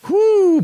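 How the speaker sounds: high, loud whoo; an overblown whoop. Acted.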